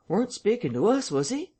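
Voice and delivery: high-pitched, wondering question; puzzled eavesdropper. A narrator's reading. Listeners at the parlour door confer, high and puzzled. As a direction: ask it high, in some doubt.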